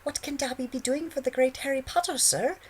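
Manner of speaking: very high, loud squeaky elf; overly deferential squeaky elf; house elf voice. A narrator's reading. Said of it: "he said with exaggerated deference".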